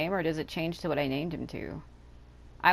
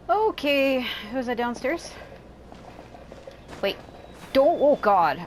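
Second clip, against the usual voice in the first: jolted by something onscreen; startled outburst; 6 semitones higher than usual. Real, not acted. Sudden alarm during gameplay.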